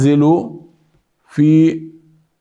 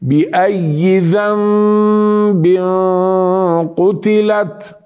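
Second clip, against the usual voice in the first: slow, higher-pitched tajweed chant; Quran recitation; 4 semitones higher, much slower than usual. Shown as a demonstration. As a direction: recite with a long nasal hum on ذنب. (تلاوة مرتلة مع الغنة)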